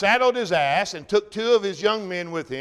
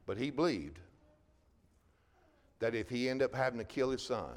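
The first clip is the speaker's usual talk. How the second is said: hushed and solemn; 5 semitones lower, 6 dB quieter than usual. Real, not acted. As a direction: drop to a soft, grave hush. Pastor turns hushed over Abraham's ordeal.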